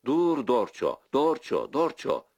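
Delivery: high loud calls after a runaway horse; calling the horse. A narrator's reading. Andreşko calling after his fleeing horse Dorço, loud calls. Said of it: "diye sesleniyor".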